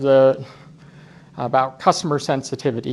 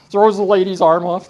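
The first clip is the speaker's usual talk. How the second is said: usual speaking voice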